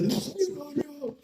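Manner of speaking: tiny, very high squeal; hammed-up helpless squeak; mock panic. Bro sees his bleeding thumb, squealing in mock panic.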